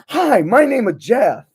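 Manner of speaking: high loud mock Jeff voice; Jeff meme. Joking what Matt Pat should say, high silly meme voice. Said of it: "Matt Pat said".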